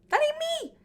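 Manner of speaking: a sassy, flippant brush-off; sassy dismissal. Real, not acted. Brushing off critics of her clothes, high and sassy. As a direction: shoot way up high on it.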